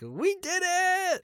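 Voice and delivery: very high, drawn-out celebratory scream; hammed-up high squeal of triumph; mock screaming. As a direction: scream it in disbelief at being alive. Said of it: "and he's screaming".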